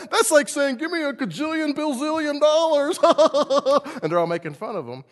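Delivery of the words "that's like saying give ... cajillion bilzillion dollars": high, loud words through laughter; mocking laughter. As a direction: laugh while ridiculing the sum. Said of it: "the whole cabinet starts laughing with him".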